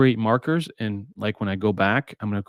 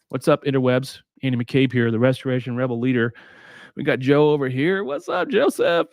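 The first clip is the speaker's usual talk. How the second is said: upbeat podcast host opening; show host intro; 3 semitones higher than usual. Acted. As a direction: welcome the audience like a host. Opens the show.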